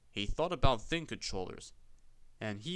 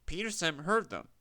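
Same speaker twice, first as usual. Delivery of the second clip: plain reading voice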